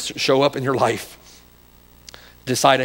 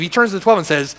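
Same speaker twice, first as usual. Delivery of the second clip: ordinary talk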